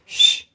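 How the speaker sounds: sharp, loud "shh"; loud whistling shush. Shown as a demonstration. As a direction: hush the room with one piercing shush. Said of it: "That was a good whistle".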